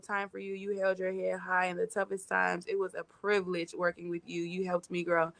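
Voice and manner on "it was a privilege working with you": ordinary talk, nothing marked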